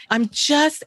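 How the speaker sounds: normal talking voice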